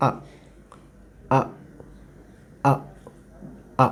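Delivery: a very short, weak "আ" repeated for students to copy; teacher modelling "আ" as a quick, unstressed uh; short schwa a. Shown as a demonstration. Teacher drills the schwa sound for learners, short and weak. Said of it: "এভাবে আপনারা আমার সাথে উচ্চারণ করার চেষ্টা করেন".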